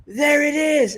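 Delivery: very high, loud mock coach's cry; Castillo. Co-host mimicking the coach celebrating again, high excited yell. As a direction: yelp it in triumph, mocking the cornerman. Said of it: "and he goes".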